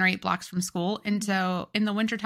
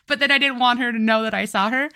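normal talking voice

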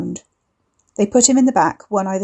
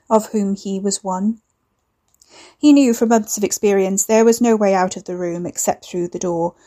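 usual speaking voice

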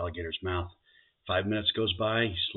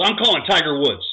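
louder, higher retort; fed-up husband; 8 semitones higher, 11 dB louder than usual. Acted. Husband fed up with his bride, loud retort. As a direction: shout the punchline back. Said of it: "He goes".

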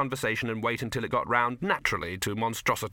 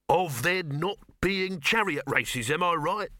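slow, higher, oily threat; soapy menace; 7 semitones higher than usual. A narrator's reading. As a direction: menace wrapped in soapy politeness. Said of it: "in soapy tones containing the razor blade of menace".

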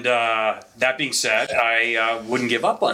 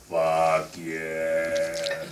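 dragged-out, much lower, softer slowed tape; slowed-down clip; 7 semitones lower, 4 dB quieter than usual. Retold or imitated. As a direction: play it like footage slowed to a crawl. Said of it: "I slowed it down".

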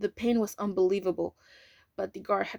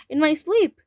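agitated; 7 semitones higher, 9 dB louder than usual. Acted. Parsons' anxious confession.

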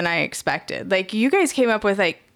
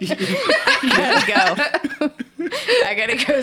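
laughing; 7 semitones higher, slower than usual. Pretend. Repeats while laughing.

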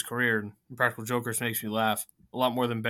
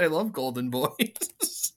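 laughing mock quote; 3 semitones higher, much slower than usual. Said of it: "You said".

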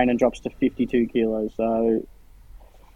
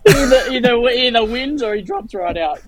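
laughing; 11 semitones higher, 3 dB louder than usual. Real, not acted. Laughing mock repeat.

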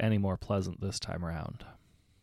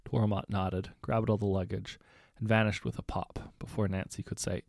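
ordinary delivery, nothing marked

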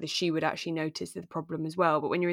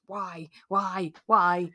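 frustrated why; much slower than usual. Retold or imitated.

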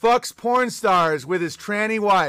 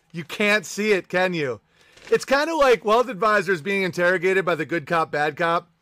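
ordinary talk, nothing marked

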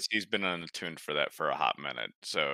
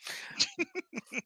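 shrieking laugh; 6 dB quieter than usual. Real, not acted. Player bursts out laughing.

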